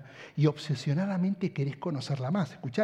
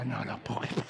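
low and much softer, a muttered aside; dad's disbelief; 5 semitones lower, 7 dB quieter than usual. Retold or imitated. Father overhearing his son's phone code, low and softer. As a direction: mutter it under your breath. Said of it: "Mi papá me decía".

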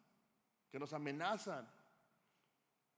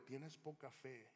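soft, very slow reproach; gentle rebuke; 8 dB quieter, much slower than usual. Acted. Jesus telling sinking Peter he has little faith, soft gentle rebuke. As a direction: correct him tenderly, without anger.

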